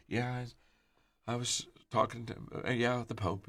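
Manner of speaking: hushed, hesitant imitated mumble; mumbling to himself. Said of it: "kind of mumbling to himself".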